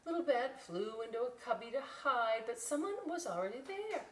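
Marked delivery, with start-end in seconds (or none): none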